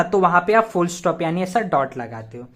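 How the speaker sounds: usual speaking voice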